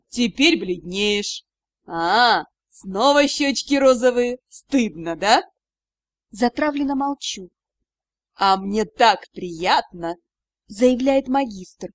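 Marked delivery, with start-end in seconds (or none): 0.1-5.4 lower, slow gloating tease; mocking magister. Magister mocks blushing girl. 8.4-10.2 gloating. Acted. Magister gloats over her.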